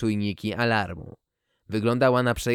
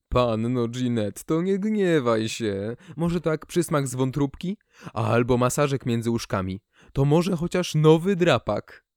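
pleading cat owner; 2 semitones higher than usual. Acted. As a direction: beg the cat sweetly, rising on the last line.

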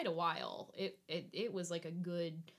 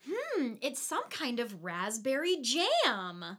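higher, slow, put-on pondering voice; clueless daughter; 5 semitones higher, 4 dB louder, slower than usual. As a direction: draw it out like a dim taster savouring it. Acts daughter tasting blood cluelessly.

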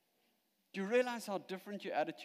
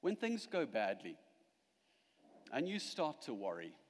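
ordinary delivery

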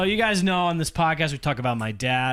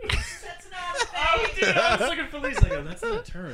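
amused disbelief. Real, not acted. Mocking made-up phrase.

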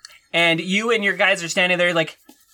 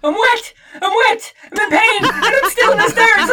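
mock hysterical; 12 semitones higher, 5 dB louder than usual. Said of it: "I'm hysterical".

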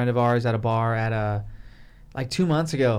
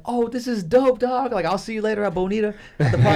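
much higher, excited buddy voice; hyped-up teen banter; 7 semitones higher than usual. Retold or imitated. Teen friends before the game, excited high banter. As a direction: play the pumped kid greeting a friend. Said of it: "So we were like".

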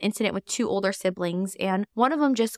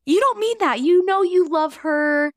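high, louder, insistent correction; dismissive parent; 8 semitones higher, 5 dB louder than usual. Retold or imitated. Parent to toddler wanting baby gone, high insistent denial. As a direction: brush the feeling away firmly. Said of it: "naturally we wanna say".

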